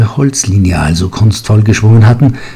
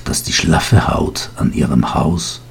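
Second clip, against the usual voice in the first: plain reading voice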